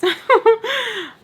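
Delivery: sudden loud, high-pitched laugh. Real, not acted. Storyteller breaking off before the next part, laughing. Own laugh between stories.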